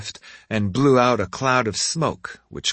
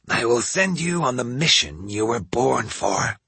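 put-on old woman voice; 4 semitones higher than usual. A narrator's reading. Old lady giving orders.

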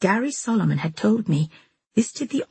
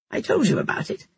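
distracted; 4 semitones higher than usual. A narrator's reading. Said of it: "Da said distractedly".

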